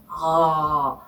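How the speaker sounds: a low throaty gargled "eo" from the back of the throat; throaty gargle sound. Shown as a demonstration. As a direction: make the French R sound like gargling. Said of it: "목구멍에서 나오는 이 소리가".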